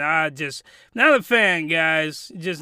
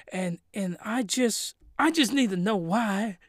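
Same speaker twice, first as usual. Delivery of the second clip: much softer, gentle and hesitant; soft and pleading; 3 semitones lower, 9 dB quieter than usual. Retold or imitated. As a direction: plead softly, almost hushed. Glasses chick's gentle bath confession.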